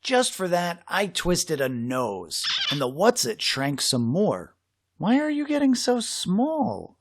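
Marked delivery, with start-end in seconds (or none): 5.0-7.0 puzzled question. Acted. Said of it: "I asked".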